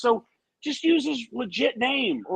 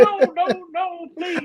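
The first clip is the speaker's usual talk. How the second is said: mock cheering voice; 6 semitones higher than usual. Co-host mocking the cheering voice, high pleading. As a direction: beg the wrestler in hammed-up panic. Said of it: "that's me in my, my cheering voice".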